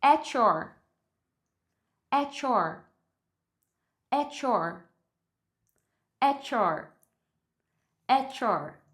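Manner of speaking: "At your" drilled as "a-chor", falling; repeat-after-me "At your" with a ch for T and Y; T plus Y becomes CH. Shown as a demonstration. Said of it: "Eu vou falar at your".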